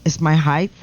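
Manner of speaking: ordinary talk, nothing marked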